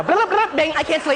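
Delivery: high-pitched manic outburst; wired, over-caffeinated high voice; hyper. Acted. Hyper couple awake in bed, high manic outburst. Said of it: "woman who is just as hyper as I am".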